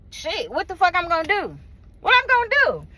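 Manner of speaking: high-pitched, exasperated self-questioning; helpless outcry, climbing in pitch; exasperated helplessness. Acted.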